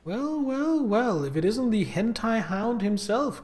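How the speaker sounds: high, loud mock villain greeting; teasing greeting. Real, not acted. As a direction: greet him like a gloating villain. Greeting a chat viewer.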